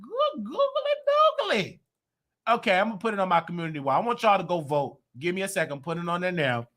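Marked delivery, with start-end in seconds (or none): 0.0-1.8 very high, slow, squeaky exclaim; hammed-up shocked squeal; silly exclamation. Recalling stepping off the plane, a high, slow squeal. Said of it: "I said".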